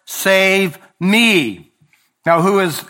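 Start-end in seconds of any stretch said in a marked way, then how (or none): 0.0-1.7 a drowning man's plea, read aloud; crying out. Acted. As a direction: Lord and me lifted higher and louder. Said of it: "he cried out".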